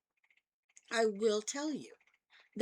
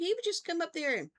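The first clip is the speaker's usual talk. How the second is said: ordinary talk, nothing marked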